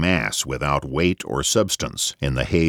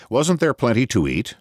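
a pushy, challenging question; truculent; 7 semitones higher than usual. A narrator's reading. Stranger on the ferry needling the narrator, truculent. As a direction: demand it like picking a fight. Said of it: "he asked in a truculent tone".

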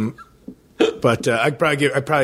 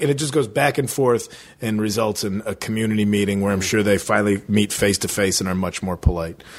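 usual speaking voice

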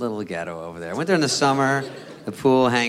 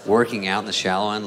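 normal talking voice

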